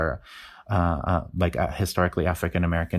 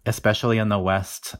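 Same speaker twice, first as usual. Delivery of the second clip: normal talking voice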